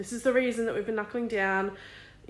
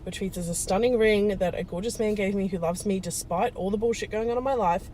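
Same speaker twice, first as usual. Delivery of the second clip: ordinary talk, nothing marked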